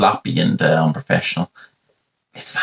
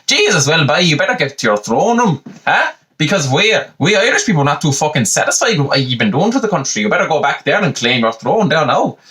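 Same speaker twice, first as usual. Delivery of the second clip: higher, jolly paddywhackery brogue; hammed-up stage Irishman; 7 semitones higher than usual. Donegal local mocks an American tourist in a high stage brogue. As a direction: play the cheeky Irish local scolding a tourist. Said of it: "and I say".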